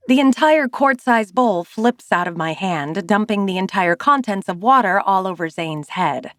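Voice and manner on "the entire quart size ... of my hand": plain reading voice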